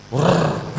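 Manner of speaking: quick imitated insect whirr; buzzing wrrr of a cockroach. Preacher imitating a flying cockroach's buzz.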